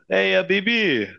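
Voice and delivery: put-on a brief joking Middle Eastern accent, pitched high. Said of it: "let's hear your Middle Eastern accent".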